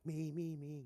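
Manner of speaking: put-on high, loud eager kids begging. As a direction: squeal it like kids wanting ice cream. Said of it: "And we're like".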